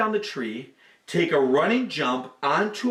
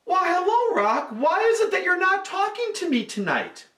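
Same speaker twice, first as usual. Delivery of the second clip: high, sweet and teasing; high monkey voice; 13 semitones higher than usual. A narrator's reading. Monkey coaxing the silent rock, high and teasing.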